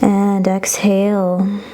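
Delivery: long drawn-out and exhale; slow exhale cue. Shown as a demonstration. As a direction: draw it out with the breath. Breath cue in yoga class.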